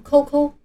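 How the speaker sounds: QQ wrongly said as "扣扣", kou-kou; "扣扣" as a common misreading of QQ; mispronounced QQ. Shown as a demonstration. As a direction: say "扣扣" with no y-glide, like "koh koh". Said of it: "这些全都是错误的读法".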